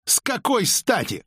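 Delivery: высокий раздражённый ответ, не громкий; огрызнись повышенным тоном; огрызаясь. A narrator's reading. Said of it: "огрызнулся Криди". (огрызаясь)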